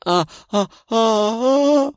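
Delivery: rising gasps of pain. A narrator's reading. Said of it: "прошипела от боли девушка".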